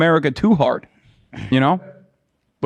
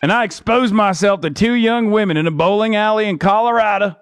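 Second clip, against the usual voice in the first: Jason Boebert; 5 semitones higher than usual. Pretend. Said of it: "I'm Jason Boebert".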